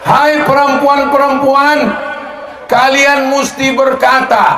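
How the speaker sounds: calling out. Real, not acted. Calling out to women.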